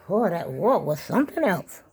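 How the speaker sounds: ordinary talk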